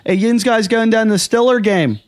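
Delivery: cheerful Pittsburgh yinzer voice; lightly voiced Pittsburgh fan; Pittsburgh accent. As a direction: hype the Steelers game like a Pittsburgh local. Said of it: "they would say".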